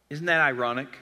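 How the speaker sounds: ordinary talk, nothing marked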